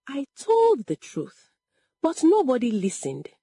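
high, hurt lament; shaking voice. A narrator's reading. Unbelieved daughter alone at night, shaking voice. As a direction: lament with pitch lifted. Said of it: "Her voice shook".

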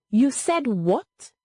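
slow, high incredulous question; slow, pointed demand to hear it repeated; asked slowly. A narrator's reading. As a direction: draw the words out, pitch climbing. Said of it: "she asked slowly".

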